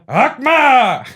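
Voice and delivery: high, loud mock battle cry; hammed-up fighter battle cry; catchphrase shout. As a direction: yell it like a kung fu fighter. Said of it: "The catchphrase fighting".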